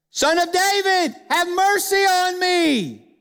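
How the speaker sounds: high, drawn-out pleading shout; crying out. Acted. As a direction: cry out to get his attention. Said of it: "But he cries out".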